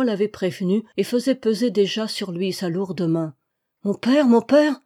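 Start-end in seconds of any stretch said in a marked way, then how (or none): 3.8-4.8 high, loud plea; urgent, despairing call; desperate cry. A narrator's reading. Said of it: "cria encore Marie avec désespoir".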